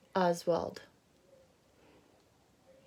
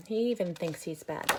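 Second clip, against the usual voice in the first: usual speaking voice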